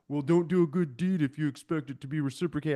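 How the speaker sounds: a lightly voiced preachy commenter, lecturing; mocking online critics. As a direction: parrot the know-it-all replies with mild mockery. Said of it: "everyone online was like".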